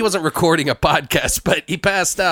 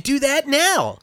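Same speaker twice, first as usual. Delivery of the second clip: high dramatic hype; 11 semitones higher, slower than usual. Acted. Hosts hyping segment.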